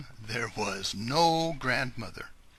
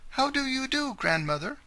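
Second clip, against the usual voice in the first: falsetto greeting; 14 semitones higher, 4 dB louder than usual. Acted. Wolf greets grandmother sweetly.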